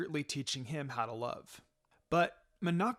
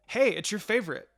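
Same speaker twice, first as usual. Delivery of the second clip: higher and louder, eager call; excited; 6 semitones higher, 7 dB louder than usual. A narrator's reading. Minako pulling Itachi to the food contest, excited and loud. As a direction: burst out with delight at the contest. Tugging him to dango stand.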